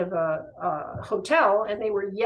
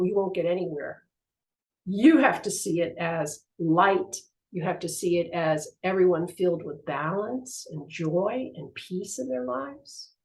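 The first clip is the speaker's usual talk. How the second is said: ordinary talk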